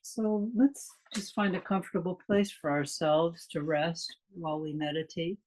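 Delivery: calm, unhurried meditation guide with long pauses; measured meditation delivery, leaving space after each phrase; guided meditation. Real, not acted. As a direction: lead listeners gently into stillness, phrase by phrase.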